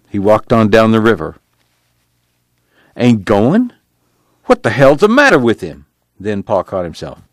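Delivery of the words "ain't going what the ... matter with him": higher-pitched, indignant disbelief; a father's startled, exasperated questioning; incredulous outburst. A narrator's reading. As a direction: react in baffled indignation, pitch lifting. Father reacts to son's refusal.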